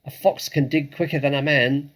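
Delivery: rally the family; rousing shout. Acted. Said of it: "shouted Mister Fox".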